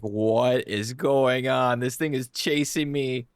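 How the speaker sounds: slow, drawn-out disbelief; mock bewilderment. Retelling a first run-in with the game's monster, mock bewilderment. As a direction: draw out the words as if replaying your alarm. Acts out his first panicked reaction.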